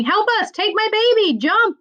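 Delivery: high, frantic cries for help; panicked shouting. Acted. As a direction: yell as passengers fleeing a sinking ship. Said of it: "Some were running and shouting".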